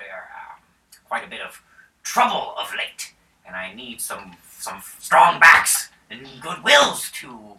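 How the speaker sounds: a hint of a stately noble asking for help; mildly grand baron; lightly voiced baron. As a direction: speak as a courteous lord offering gold.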